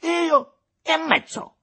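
very high, loud, drawn-out disbelief; indignant outburst. A narrator's reading. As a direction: throw it back, appalled. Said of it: "proruppe Aurelio Costa". (incredulità indignata, acuta e forte)

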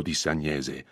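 usual speaking voice

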